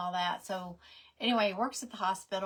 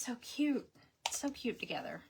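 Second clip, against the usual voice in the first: soft whisper; 2 semitones higher, 3 dB quieter than usual. Real, not acted. Gushing about a couple.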